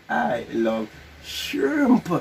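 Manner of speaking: very slow mouthing. Real, not acted. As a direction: stretch every word out.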